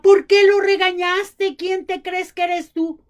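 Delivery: mock outrage at the husband; wife scolding husband for scolding son; angry wife. Mother scolds father.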